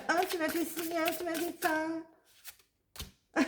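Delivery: soft, slow whiny child mimicry; mock a sulking child, drawn out; mocking whiny kid. Mocking childish people who complain, soft whiny drawl. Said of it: "c'est des gamins".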